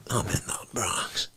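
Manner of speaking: hushed, low mob phone message; low whisper. Acted. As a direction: whisper it like a threat from the Bronx.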